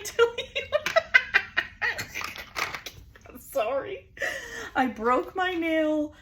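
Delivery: laughing so hard the words come out high, loud and slow; giggling apology through tears of laughter. Real, not acted. Laughing about her broken nail.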